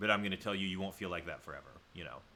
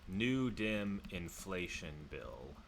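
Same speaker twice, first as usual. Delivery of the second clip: slow, stretched words while looking something up; drawn-out search muttering; much slower than usual. Real, not acted. Streamer looking up a bill for viewers, slow and drawn-out. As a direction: sound out each word slowly, half to yourself. Let me pull this up for you.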